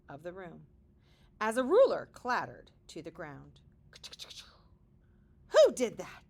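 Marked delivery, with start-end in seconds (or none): 5.5-6.2 shouted, startled; loud alarmed question. Acted. Startled kids shouting at the crash. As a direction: call it out in alarm.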